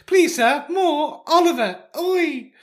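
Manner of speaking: high, slow Cockney urchin; mock Cockney English. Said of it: "that is like Cockney English".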